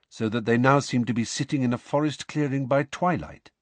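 ordinary delivery

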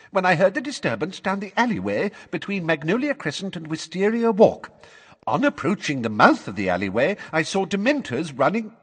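high-voiced rote recital; recited testimony. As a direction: recite it as if learned by heart. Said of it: "learned what she was saying by heart".

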